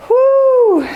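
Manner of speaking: high, loud whoo of effort mid-set; breathless high whoop between reps; exertion whoo. Real, not acted. Exhale between workout reps.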